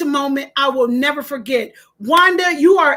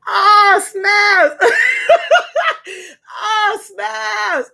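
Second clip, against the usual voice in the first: excited squeal; 6 semitones higher, 4 dB louder, much slower than usual. Real, not acted. Squealing with delight at super chat.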